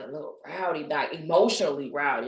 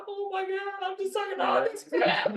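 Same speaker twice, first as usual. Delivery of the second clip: overwhelmed cry. Retold or imitated. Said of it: "and I was loud".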